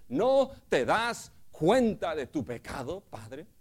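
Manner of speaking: loud, high, urgent appeal to a father; the son confronting his father, loud and pleading. Retold or imitated. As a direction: press the father with rising questions.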